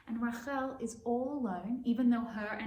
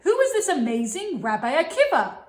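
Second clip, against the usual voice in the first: much louder and higher, booming; loud, high puppet voice of the father; gruff grandfather puppet; 7 semitones higher, 16 dB louder than usual. Acted. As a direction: boom it out as the proud old father. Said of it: "Kalba Savua was like".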